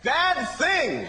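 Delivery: sudden high warning cry; half-hearted yell of warning; mock yell. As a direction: blurt a warning at a cyclist. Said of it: "So I just yelled out".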